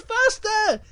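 very high, excited squeal; eager cub's cry. Acted. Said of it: "he goes".

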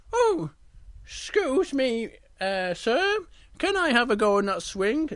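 high, hesitant cub request; polite little bear. Acted. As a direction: ask politely in a small high voice. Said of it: "And he's, he said".

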